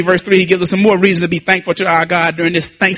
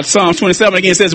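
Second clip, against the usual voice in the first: ordinary talk, nothing marked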